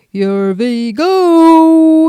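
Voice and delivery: long, slow, loud shout; cabbie shout. A narrator's reading. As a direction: whoop it out as he floors the cab. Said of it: "he shouted".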